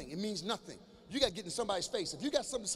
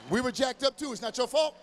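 loud reassurance; 3 semitones higher, 7 dB louder than usual. Real, not acted. Reassuring teens loudly.

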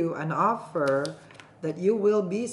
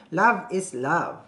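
love is love, drawn out slow; slow emphatic motto; 4 dB louder, much slower than usual. Real, not acted. To viewers, stressing "Love is love" slowly. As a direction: lean on "love" each time, slowly.

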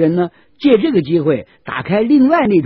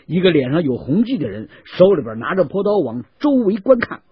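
usual speaking voice

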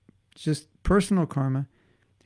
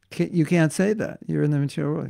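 normal talking voice

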